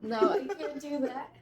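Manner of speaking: refusing through a laugh. Real, not acted. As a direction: laugh while saying no.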